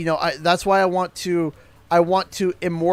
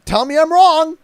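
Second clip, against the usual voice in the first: challenging shout; 10 semitones higher, 8 dB louder than usual. Real, not acted. Repeated challenge, higher.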